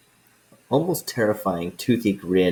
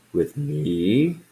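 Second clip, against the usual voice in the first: a hint of a puzzled old dragon, rising questions; lightly voiced dragon; slower than usual. Old dragon puzzles at the adventurers asking counsel, lightly voiced. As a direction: play the ancient dragon, curious and amused.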